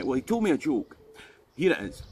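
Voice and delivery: normal talking voice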